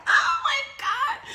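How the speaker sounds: very high squeal of disgust, laughing; disgusted gasp. Retold or imitated. Imitating her reaction when someone sneezes, high mock disgust. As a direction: shriek it in mock horror.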